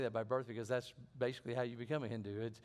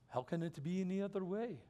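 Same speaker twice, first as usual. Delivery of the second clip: higher-pitched mock Indian accent; put-on Indian accent, pitched up; imitated Indian accent; 7 semitones higher than usual. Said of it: "pardon my poor accent".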